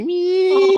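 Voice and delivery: long squeal sliding high up.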